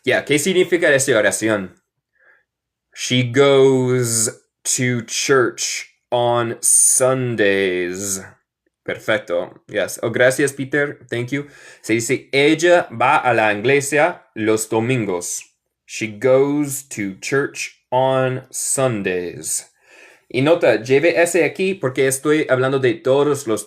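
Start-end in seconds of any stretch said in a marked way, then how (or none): 2.9-8.2 very slow, words held apart; slow teaching model. Shown as a demonstration. Teacher models the sentence for students, very slow delivery. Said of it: "Repiten después de mí". 15.8-19.7 very slow, evenly spaced words; slow teaching model. Shown as a demonstration. Teacher translates for students, very slow clear English.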